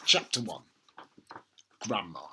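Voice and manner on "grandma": usual speaking voice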